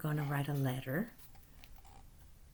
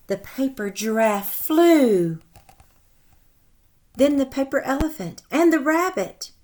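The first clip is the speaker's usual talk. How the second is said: lively read-aloud storytelling voice; animated storybook narration for children; story narration; 4 semitones higher, 7 dB louder than usual. A narrator's reading. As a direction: read it like a picture book to kids. Reads story aloud to class.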